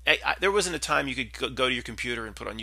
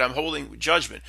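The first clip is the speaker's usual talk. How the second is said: ordinary talk, nothing marked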